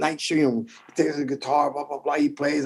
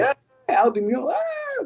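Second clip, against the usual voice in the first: crowd cheer; 9 semitones higher, 3 dB quieter than usual. Retold or imitated. Crowd cheering his name.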